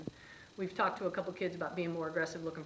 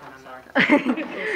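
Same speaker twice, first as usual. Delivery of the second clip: slow, loud laughing apology, voice up high; cracking up while saying sorry; laughing through a sorry; 10 semitones higher, 17 dB louder, much slower than usual. Real, not acted. Knock on wood, laughs.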